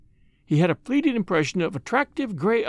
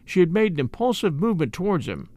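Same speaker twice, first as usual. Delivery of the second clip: plain reading voice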